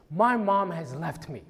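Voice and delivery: ordinary talk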